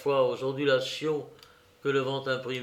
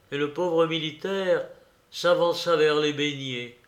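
ordinary delivery, nothing marked

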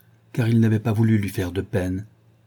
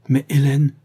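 higher, louder exclamation of amazement; astonished cry; 5 semitones higher, 6 dB louder than usual. A narrator's reading. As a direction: cry out, taken aback. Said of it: "s'écria-t-elle". (exclamation stupéfaite)

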